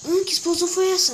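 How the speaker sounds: loud alarmed question; startled shout. Real, not acted. Explosion heard in game.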